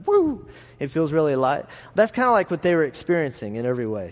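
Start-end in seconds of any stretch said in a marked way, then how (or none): 0.1-0.5 high sudden whoop of surprise; surprised whoop. Retold or imitated. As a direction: let out a startled woo. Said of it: "you're like".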